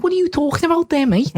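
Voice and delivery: hammed-up Liverpool Scouse voice; Scouse accent. Imitating his Scouse family on a call, in a Scouse accent. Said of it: "their Scouse accents".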